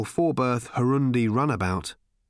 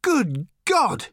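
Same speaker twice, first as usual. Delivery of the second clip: startled shout; much slower than usual. A narrator's reading. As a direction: blurt it out in shock. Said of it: "shouted Arthur".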